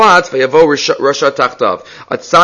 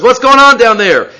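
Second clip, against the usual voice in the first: higher, louder call down a well; wolf calling down; 7 semitones higher, 5 dB louder than usual. Retold or imitated. Wolf calls down the well to the fox, higher and louder. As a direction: shout down into the well.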